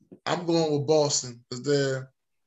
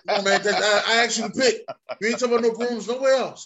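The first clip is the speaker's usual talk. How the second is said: mock outrage; 6 semitones higher, 5 dB louder, faster than usual. Real, not acted. Outraged about a sweep joke.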